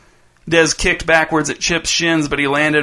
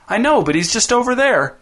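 less enthusiastic; 8 semitones higher than usual. A narrator's reading. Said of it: "less enthusiastic this time".

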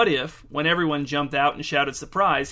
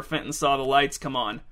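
ordinary delivery

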